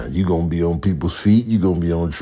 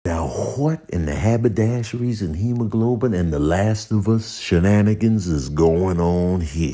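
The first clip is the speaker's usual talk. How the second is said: usual speaking voice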